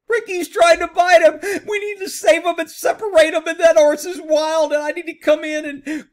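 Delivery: high, loud mocking rescuer voice; mock horse woman. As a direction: ham up a frantic horse lady. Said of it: "Who do I sound like?".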